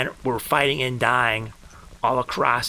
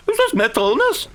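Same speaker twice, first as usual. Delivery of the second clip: mocking viewer; 13 semitones higher, 5 dB louder than usual. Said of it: "mocking viewer's mental illness claim".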